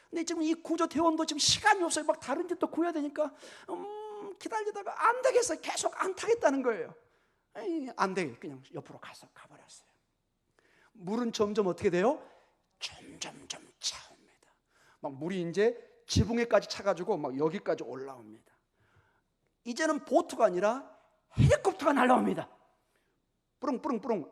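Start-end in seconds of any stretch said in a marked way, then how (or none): 0.1-8.3 high, loud pleading voice; exasperated rescuer. Retold or imitated. Acting hurried rescuer pleading with man. 23.6-24.3 high puttering mock rotor sound; imitated helicopter noise. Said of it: "헬리콥터 그런 소리 아니죠".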